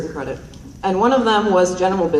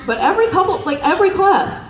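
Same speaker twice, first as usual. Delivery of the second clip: ordinary delivery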